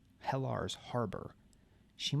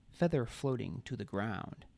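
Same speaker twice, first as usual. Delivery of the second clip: usual speaking voice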